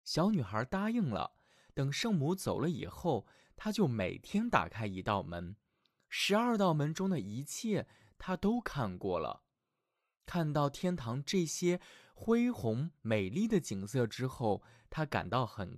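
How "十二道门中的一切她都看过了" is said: plain reading voice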